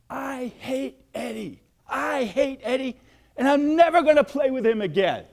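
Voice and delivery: put-on high, loud angry child's outburst, building; a furious little boy telling his mom. Little boy telling mom he hates Eddie, angry high outburst. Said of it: "I was angry".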